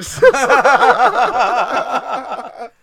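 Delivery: loud, high burst of laughter; big laugh. Real, not acted. Laughing at the memory.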